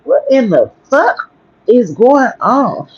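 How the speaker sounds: a retold stunned outcry; high, slow, disbelieving. Facing the man snatching her son, high slow disbelief. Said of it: "I'm like".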